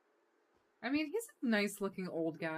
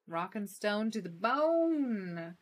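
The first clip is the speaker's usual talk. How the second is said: drawn-out rallying cry on "bone"; playful, rising battle cheer; dwarf-style cheer; 4 semitones higher, 5 dB louder, slower than usual. Pretend. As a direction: cheer it slowly, stretching the big word. Dwarf cheer after beard compliment.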